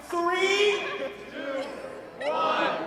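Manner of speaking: loud, high call to start; shouted countdown. Real, not acted. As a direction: fire up the racers. Second round countdown.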